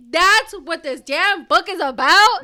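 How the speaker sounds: shocked outburst. Real, not acted. To her co-hosts, disbelieving the book copies a movie, slow loud shock. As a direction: spell out each word in disbelief.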